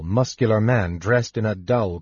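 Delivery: ordinary delivery, nothing marked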